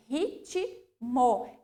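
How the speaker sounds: "Ritmo" said "hitchimo", T and M both sounded; careful T and M. Shown as a demonstration. Teacher modelling the separate consonants. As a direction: sound the T, then the M. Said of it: "eu pronuncio as duas".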